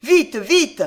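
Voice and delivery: high, loud, pressing command; urgent order. A narrator's reading. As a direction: order them to hurry. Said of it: "ordonna-t-elle".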